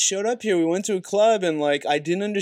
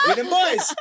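laughing quote; 9 semitones higher than usual. Retold or imitated. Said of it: "I was like".

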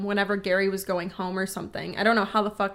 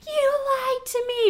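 high accusing voice. Retold or imitated. Angry mother quoted in story.

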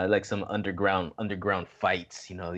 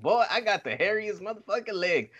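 high taunting self-quote; 10 semitones higher than usual. Retold or imitated. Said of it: "I'm like".

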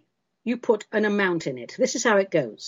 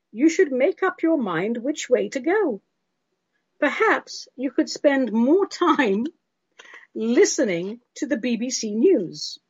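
higher, measured voice of a fussy critic; prim listener's letter; 4 semitones higher than usual. Acted. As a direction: lecture sternly, drawing out key phrases. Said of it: "This is from Sandy".

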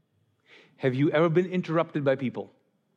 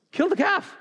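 high, exultant call; joyful command; 13 semitones higher than usual. Retold or imitated. Father orders the fatted calf for the feast, exultant. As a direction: cry it out in celebration.

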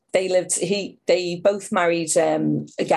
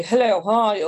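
Henry; 3 semitones higher, slower than usual. Retold or imitated. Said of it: "But Henry was very".

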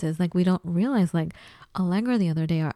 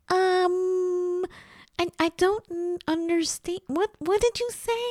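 put-on high Los Angeles Valley girl accent, stammering; confused Santa Monica Valley girl accent; 11 semitones higher than usual. Mimicking herself in a Santa Monica accent to the fast-talking clerk. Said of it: "that L A, like, Santa Monica accent".

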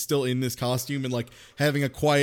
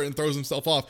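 ordinary talk, nothing marked

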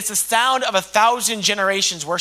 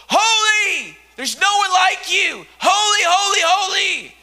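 worshipful shout; 9 semitones higher than usual. Acted. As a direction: shout it out to the throne in awe. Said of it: "And they're shouting".

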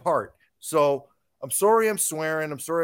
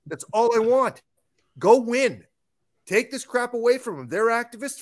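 yelling rant; 5 semitones higher, 5 dB louder than usual. Real, not acted. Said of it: "five minutes of yelling".

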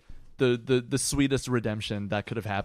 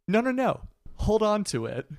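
higher-pitched retold insistence; girlfriend insisting; 8 semitones higher than usual. Girlfriend pressing her key on the boyfriend, high and insistent. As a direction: imitate a girlfriend brushing off objections, pitched up.

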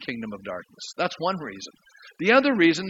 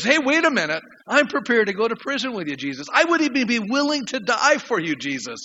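higher, louder indignant protest; indignant outburst, raised pitch and volume; 6 semitones higher, 4 dB louder than usual. Acted. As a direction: protest loudly, insulted at being doubted. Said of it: "Peter's indignant by this".

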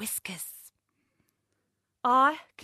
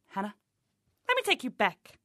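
ordinary talk, nothing marked

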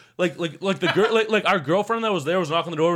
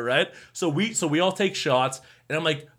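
ordinary talk, nothing marked

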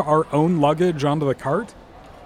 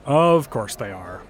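sarcastic; 5 semitones lower, slower than usual. Acted. Scoffs at hold message.

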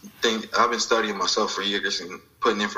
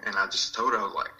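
usual speaking voice

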